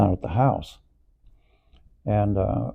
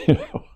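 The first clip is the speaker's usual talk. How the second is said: stifled laugh; 7 semitones higher, 4 dB louder than usual. Real, not acted. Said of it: "busting up inside".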